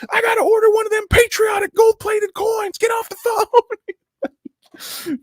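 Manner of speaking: hammed-up, very high strained yell; Shane Gillis's Fox News dad. Streamer retelling a comedian's bit about Fox News dads, hammed-up shouting. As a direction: do an agitated TV-watching dad in high falsetto. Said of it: "He's like".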